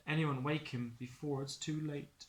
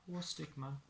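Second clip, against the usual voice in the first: ordinary delivery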